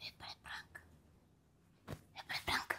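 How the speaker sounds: unvoiced whisper; hushed repeat. Real, not acted. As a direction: whisper the question.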